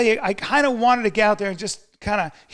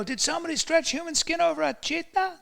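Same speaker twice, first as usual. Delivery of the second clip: high-pitched mock female voice; imitating admiring women; 10 semitones higher than usual. Women marvelling at his body, high mock voice. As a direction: put on a high voice of amazed women. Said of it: "women go".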